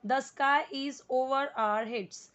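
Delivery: very slow, word by word; slow model reading. Shown as a demonstration. Teacher reads the sentence slowly for students to copy. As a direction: read each word out for students to repeat. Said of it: "जैसे जैसे मैं जिस word को पढ़ूँ आपको उसी तरीके".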